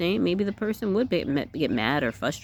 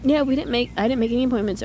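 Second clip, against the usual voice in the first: carefree; 8 semitones higher, much faster than usual. Shown as a demonstration. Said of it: "Just not, trying not to act in a way".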